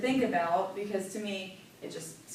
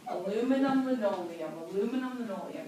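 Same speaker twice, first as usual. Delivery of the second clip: tongue twister demo. Shown as a demonstration. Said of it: "here is a tongue twister".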